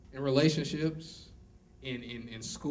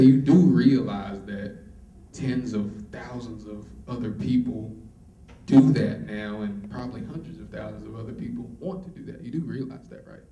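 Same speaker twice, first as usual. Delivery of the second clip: sober mentor voice; 5 semitones lower, 6 dB louder than usual. Retold or imitated. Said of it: "he said".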